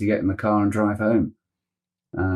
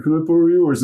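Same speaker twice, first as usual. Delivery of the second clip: pleading ask; 6 semitones higher, 3 dB louder, faster than usual. Retold or imitated. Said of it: "he looked at Scott and went".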